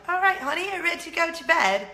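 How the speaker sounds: high, loud mock British accent; hammed-up British accent, pitched well up. Wife calling husband to bed after British TV, hammed-up British accent. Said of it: "obsessed with British accents".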